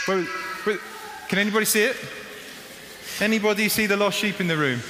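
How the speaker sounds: acting the shepherd, calling for a lost sheep; shepherd searching. Preacher as shepherd calling to the congregation, searching. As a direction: call out to the flock for the missing sheep.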